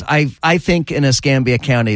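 ordinary delivery

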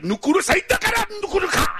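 high, shouted mock Japanese syllables; mock Japanese gibberish. Imitating Japanese speech, shouted high. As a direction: bark out fake Japanese like a samurai film. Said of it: "Japanese sounds like".

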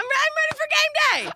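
giddy high-pitched cheer; excited squeal. Real, not acted. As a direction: squeal it, you can't wait for game day.

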